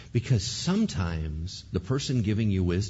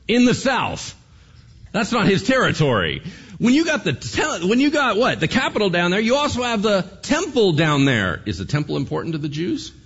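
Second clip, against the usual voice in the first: ordinary delivery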